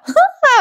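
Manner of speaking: high, quick laugh; real burst of laughter. Real, not acted. Narrator laughing at the funny scene. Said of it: "मी ना या सीनवर खूप हसले".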